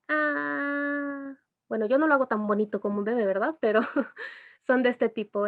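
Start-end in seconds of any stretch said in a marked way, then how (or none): 0.1-1.3 put-on baby cooing sound. Shown as a demonstration. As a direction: coo like a contented baby. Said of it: "estos soniditos que hace un bebé como tipo".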